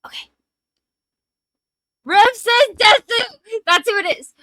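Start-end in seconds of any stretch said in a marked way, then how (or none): 2.0-4.3 loud, triumphant realization; excited shout. Real, not acted. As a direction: shout it out as it suddenly comes to you. Suddenly remembers the username.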